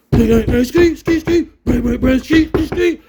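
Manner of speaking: loud, high bang bang ski; imitating rattling cell doors. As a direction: beat out the cell doors with your voice. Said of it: "just these metal doors rocking".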